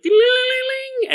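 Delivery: very high ding-a-ling chant; a cult leader's mock magic chime; silly high singsong. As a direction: ring it out like a tiny bell in falsetto. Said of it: "I'm like".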